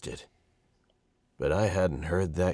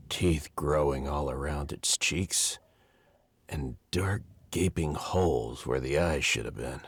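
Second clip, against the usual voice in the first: ordinary delivery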